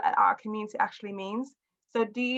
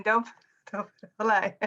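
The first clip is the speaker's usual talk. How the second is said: laughing. Real, not acted. Repeats teasing while laughing.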